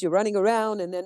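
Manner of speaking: normal talking voice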